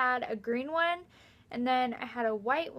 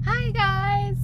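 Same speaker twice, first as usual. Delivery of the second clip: cheerful greeting; 9 semitones higher, 5 dB louder than usual. Real, not acted. Greeting camera.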